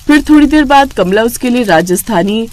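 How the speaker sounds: usual speaking voice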